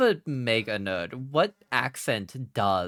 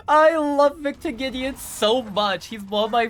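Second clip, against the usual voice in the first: gushing through laughter; 13 semitones higher, 7 dB louder than usual. Real, not acted. Fan adoring a game villain, laughing squeal. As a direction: squeal it out louder while laughing.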